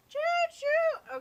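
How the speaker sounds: high whooping choo choo; train call. Pretend. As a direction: cheer like a train whistle. Calling the train onward.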